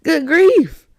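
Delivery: mock exasperation; exasperated surprise. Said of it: "I'm like".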